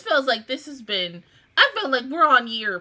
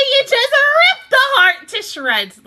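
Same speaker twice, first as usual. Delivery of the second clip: high, loud wail of protest; dramatic outcry; 13 semitones higher, 8 dB louder than usual. Real, not acted. Viewer protesting a heartbreaking plot, dramatic outcry. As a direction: cry out as if wounded by it.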